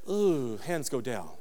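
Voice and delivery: ordinary talk, nothing marked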